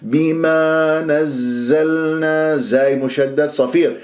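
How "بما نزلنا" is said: very slow, sustained recitation; tajweed recitation. Shown as a demonstration. Teacher recites بما نزلنا for students, very slow tajweed. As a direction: recite بما نزلنا with held vowels and doubled z.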